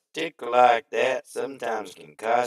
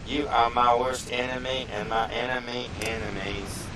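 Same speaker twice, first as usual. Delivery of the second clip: ordinary talk, nothing marked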